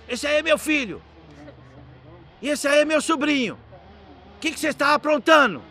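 high-pitched indignant scolding; imitated angry father. Father finding his son held by police, mock angry. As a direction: act out a cross dad, pitched well up. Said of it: "Bravo, eu falei".

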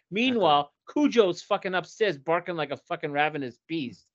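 normal talking voice

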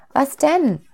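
high, curious little soul. A narrator's reading. Little soul asks God what the catch is, high and curious. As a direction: ask in a small high voice.

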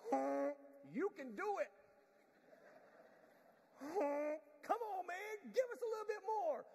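high, loud encouraging cheer. Pretend. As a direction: cheer them on, come on. Geese cheering the leader on.

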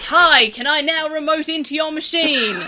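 mock sales pitch into tech help; auctioneer voice on IT support. IT helper imagined talking like an auctioneer. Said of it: "and I'd be like".

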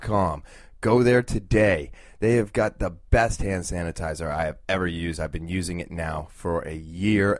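upbeat sponsor read, spelling out the web address. Acted. Podcast host reading a sponsor ad to listeners, upbeat ad read. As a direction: sell the product like a radio spot.

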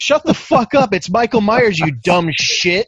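loud, high angry yell, falling at the end; irate retort. Retold or imitated. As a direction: yell back fed up, slowing on the insult. Retold angry retort to heckler.

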